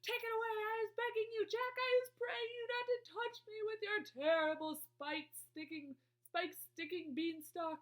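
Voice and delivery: high, loud, terrified begging; screamed pleading. A narrator's reading. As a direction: wail for mercy like a panicking giant. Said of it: "screamed the flesh lump eater".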